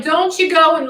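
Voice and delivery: put-on high, loud stab at a New York accent; attempted New York accent. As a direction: try out a New Yorker's accent. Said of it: "let me get my accents on".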